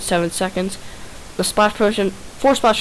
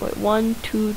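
ordinary talk

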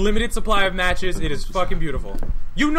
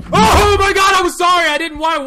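high, loud startled shout; 6 semitones higher, 6 dB louder than usual. Real, not acted. Gamer jumping at a sudden scare.

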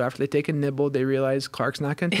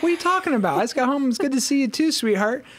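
high-pitched, baffled dad; retold protest, pitched way up; acted bafflement; 11 semitones higher, 4 dB louder, faster than usual. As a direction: play up the innocent confusion. Dad retells his baffled reply.

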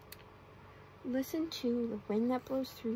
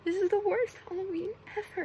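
higher, louder whiny lament; mock crying complaint; 8 semitones higher, 5 dB louder than usual. A narrator's reading. SpongeBob upset after everyone laughed, mock crying. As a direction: read it as upset storybook wailing. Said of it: "SpongeBob started to cry".

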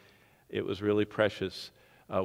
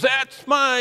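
much higher, louder mock cheer from the stands; proud dad's cheer; 10 dB louder than usual. Proud dad in the stands cheering his child, high loud shout. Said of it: "that dad in the stands that goes".

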